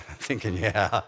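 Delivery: chuckling through "Yeah"; wry chuckle in a sermon story; laughing aside. Real, not acted. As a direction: laugh at your own misery. Laughs over the punchline.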